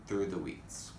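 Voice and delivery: plain reading voice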